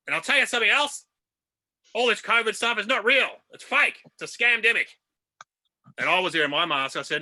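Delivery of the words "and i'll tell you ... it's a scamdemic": higher, louder mock rant; hammed-up defiant outburst; defiant ranter. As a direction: play an indignant conspiracy believer. Acts out defiant anti-masker's rant.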